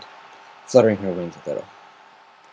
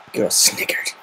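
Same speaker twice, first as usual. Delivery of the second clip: ordinary delivery, nothing marked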